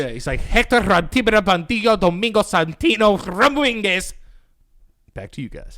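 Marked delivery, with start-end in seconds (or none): 0.4-4.1 loud, high newsreader name in heavy Spanish; mad Spanish. Acted. Mimicking a newsman saying his name, mad Spanish accent. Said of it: "he just says it mad Spanish".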